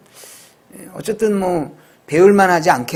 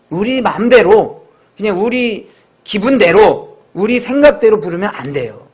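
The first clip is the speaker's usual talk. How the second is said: preaching; 4 semitones higher, 4 dB louder than usual. Real, not acted. Pastor preaching with raised voice.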